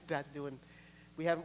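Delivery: ordinary talk, nothing marked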